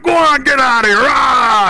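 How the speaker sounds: high full-voiced holler to drive off a threat. Hunter to a pack of wolves surrounding him, hollering. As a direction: holler it out, drawing out the last roar. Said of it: "started hollering out".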